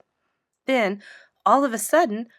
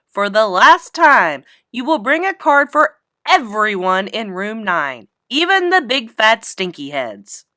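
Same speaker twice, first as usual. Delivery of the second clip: loud, slow, fed-up teacher; exasperated teacher; 8 dB louder than usual. Acted. Teacher scolding Junie B in front of the class, loud. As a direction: lay down the law, done arguing.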